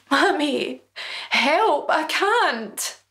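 put-on high desperate child's voice crying for help; pleading boy. A narrator's reading. Frozen son begging his mother for help, high and desperate. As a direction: beg your mummy, near tears.

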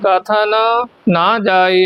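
ਕਥਨ kept apart from ਨਾ; separated words. Shown as a demonstration. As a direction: pause between ਕਥਨ and ਨਾ ਜਾਈ. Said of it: "ਇਸ ਤਰ੍ਹਾਂ".

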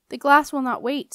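loud, insistent warning; urgent. A narrator's reading. Marcellus warns the glass will not wait, urgently. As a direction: press them hard to hurry. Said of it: "said Marcellus urgently".

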